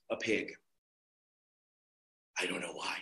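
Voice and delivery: ordinary delivery, nothing marked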